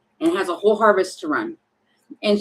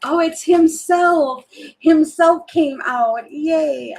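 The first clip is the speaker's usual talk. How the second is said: delighted reveal; 6 semitones higher, 5 dB louder, much slower than usual. Real, not acted.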